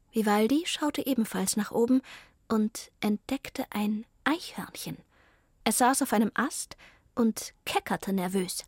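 ordinary delivery, nothing marked

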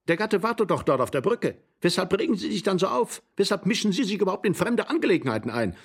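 high-pitched, heated protest; flustered, quarrelsome outburst; agitated rebuke. Acted.

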